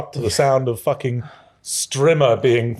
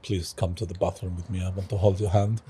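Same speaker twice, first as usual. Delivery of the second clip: lower, much softer clingy plea; needy girlfriend; 7 semitones lower, 10 dB quieter than usual. Retold or imitated. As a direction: murmur it like a clingy partner. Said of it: "She's like".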